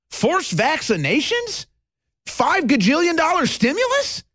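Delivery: high, incredulous mock confusion; mock bewilderment. Host voicing a confused citizen for viewers, high mock disbelief. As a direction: sound baffled and thrown, voice climbing.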